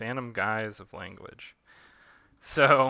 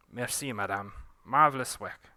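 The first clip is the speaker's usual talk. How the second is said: put-on fading Pontu accent; 4 dB louder than usual. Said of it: "losing my Pontu accent".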